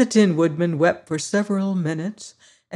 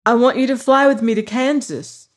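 put-on higher, louder girlish plea; Dorothy voice; 6 semitones higher, 5 dB louder than usual. Dorothy telling her friends, higher, louder plea. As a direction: plead to go home.

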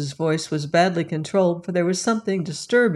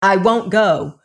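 louder, angry refusal; stormy refusal; 5 dB louder than usual. Acted. Lilas refuses the policeman, storming. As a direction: storm at him. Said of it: "stormed the girl".

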